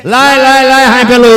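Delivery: loud, demanding call for the treat; husband yelling for the snack; impatient shout. Acted. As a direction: snap at the wife to hand it over.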